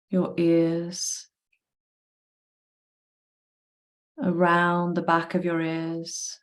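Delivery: calm, even meditation voice with long pauses; guided body scan. Real, not acted. Leading a group to relax each body part, calm guided meditation. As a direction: guide them through relaxing, one body part at a time.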